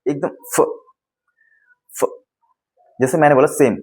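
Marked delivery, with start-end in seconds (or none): 0.5-2.1 फ puffed out with audible air; airy F sound. Shown as a demonstration. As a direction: say each फ as a breathy, separate "fuh". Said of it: "हवा निकलनी चाहिए एकदम".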